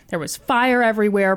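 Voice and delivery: usual speaking voice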